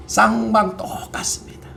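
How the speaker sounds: hushed, confidential aside; confiding whisper. Acted. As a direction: drop to a whisper as if sharing a secret.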